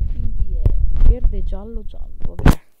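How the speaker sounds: slow, very loud realization; loud excited. Real, not acted. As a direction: call it out triumphantly. Solving level puzzle excitedly.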